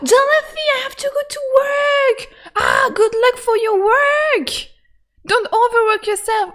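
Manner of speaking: high, laughing call; giddy laughing well-wishes; excited cheer. Real, not acted. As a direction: cheer the character on warmly. Cheering game character off.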